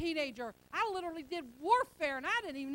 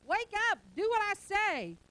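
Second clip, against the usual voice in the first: higher and louder, wake-up call; mock exasperated God; 5 semitones higher, 6 dB louder than usual. God fed up with lazy believers, mock loud. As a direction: shout it like a fed-up father. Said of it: "he ever feels like going".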